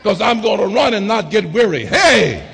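loud, climbing sermon cadence with shouted peaks; preaching at full force. Acted. Preacher rousing the congregation about the heavenly mansion, full-force preaching. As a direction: whoop it up to the congregation.

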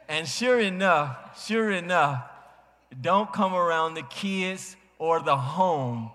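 loud, slow preacher emphasis; emphatic build. Real, not acted. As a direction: lean hard on sure enough.

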